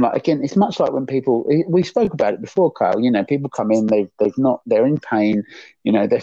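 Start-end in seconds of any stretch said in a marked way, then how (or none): none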